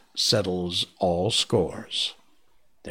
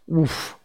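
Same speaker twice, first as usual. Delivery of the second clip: bear's relieved woof; 9 semitones higher than usual. A narrator's reading. Baloo shaking off the trance, woof. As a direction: let out a startled bear woof. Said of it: "said Baloo".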